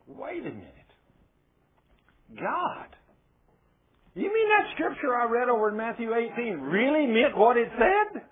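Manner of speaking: very high, incredulous outburst; astonished realization. Acted. Preacher asking God if scripture really meant it, acted astonishment.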